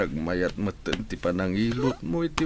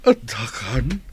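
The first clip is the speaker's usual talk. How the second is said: crying; 10 semitones higher than usual. Acted. Father moved at reunion.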